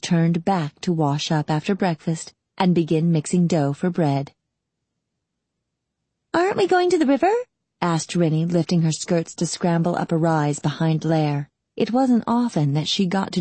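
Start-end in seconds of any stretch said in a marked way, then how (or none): 6.3-7.3 put-on high-pitched eager girl's question; questioning kid sister. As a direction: ask big brother in a high girl's voice. Girl questions her brother, high voice.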